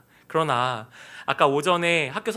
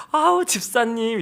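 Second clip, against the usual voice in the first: gushy, higher, drawn-out mock greeting; fake-friendly churchgoer; 4 semitones higher, slower than usual. Imitating blank-faced churchgoers greeting each other, fake-friendly. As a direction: imitate a suddenly sweet greeting. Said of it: "보통 이렇게 무표정으로 있다가도 갑자기 교회 사람들이랑 만나면 막".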